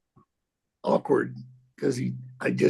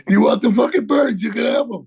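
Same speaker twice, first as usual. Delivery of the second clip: higher and louder, half-shouting; mock exasperated outburst; 7 semitones higher, 6 dB louder than usual. Telling Al he can keep the birds, a mild mock shout. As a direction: throw it back at him in mock exasperation. Heated reply about the birds.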